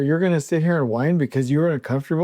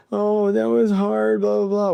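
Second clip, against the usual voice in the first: higher, slow, whiny mockery of a complainer; mock whiny excuse; 5 semitones higher than usual. Mocking whiny superintendents who complain it was hard. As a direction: drag it out like a sulking crew boss. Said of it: "the whiny, stupid excuses".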